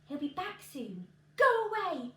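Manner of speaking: rising to a loud high hiss; woman fearing the giant's return; urgent warning. Acted. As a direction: push him away, scared and urgent.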